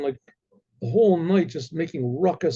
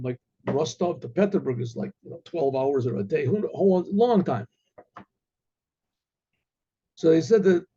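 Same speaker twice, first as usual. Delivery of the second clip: plain reading voice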